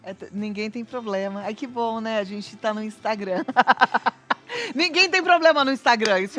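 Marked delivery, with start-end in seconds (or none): 3.5-4.7 loud, high open laugh; hearty real laugh; burst of laughter. Real, not acted. Joking about problem-free Instagram life. 4.8-6.2 loud, high and amused; joking, voice lifted by a laugh. Real, not acted. Laughing at her own joke.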